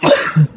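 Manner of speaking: sudden loud sneeze; a real sneeze mid-lesson; sneezing. Real, not acted. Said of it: "for the sneezing".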